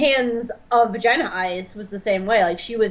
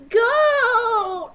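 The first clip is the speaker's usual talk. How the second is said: much higher delighted yelp; excited squeal; 13 semitones higher than usual. Retold or imitated. Spotting the goat man, much higher delighted yelp. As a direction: squeal it with joy. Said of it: "I was just like".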